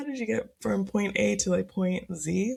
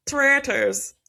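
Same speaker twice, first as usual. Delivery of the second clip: bad Irish accent; 7 semitones higher, 5 dB louder than usual. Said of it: "No, that was horrible".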